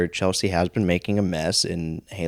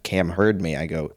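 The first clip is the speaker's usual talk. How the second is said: ordinary delivery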